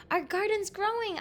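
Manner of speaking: high-pitched storybook yell; excited yell. A narrator's reading. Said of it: "I yelled".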